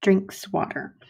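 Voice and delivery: slow, clear model sentence; example sentence read slowly. Shown as a demonstration. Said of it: "Or something completely different".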